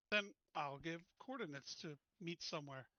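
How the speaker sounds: usual speaking voice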